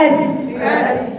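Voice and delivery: "أس" with a slow, soft stream of air on the S; breathy S demo. Shown as a demonstration. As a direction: let the air run on the S of "أس". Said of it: "تحطي إيدك عشان تشوفي فيها".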